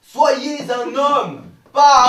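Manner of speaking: loud, slow and high; urging shout. Real, not acted. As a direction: push him with a booming call. Teacher urging student to yell.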